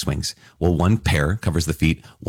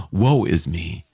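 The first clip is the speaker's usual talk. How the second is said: mock awed dread; 3 semitones higher, much slower than usual.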